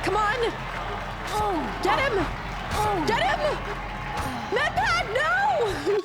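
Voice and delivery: rising, frantic urging; frantic cheering. Real, not acted. Cheering on in the tense final seconds.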